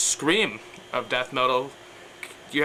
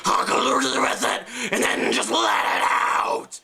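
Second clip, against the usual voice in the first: high, slow rasped scream; high screeched death-metal yell; Stitch scream; 9 semitones higher, slower than usual. Shown as a demonstration. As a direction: let it rip from the squeaky voice. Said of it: "take Stitch".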